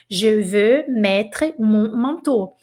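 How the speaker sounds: "Je veux mettre mon manteau" said very slowly and clearly, each French word set apart; slow French model. Shown as a demonstration. Teacher models a French sentence for beginners, very slow. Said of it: "no ícone pra ouvir".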